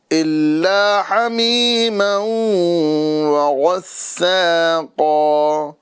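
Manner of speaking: slow, melodic tajweed chant; Quranic recitation. Acted. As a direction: recite it measured and drawn out. Recites verse.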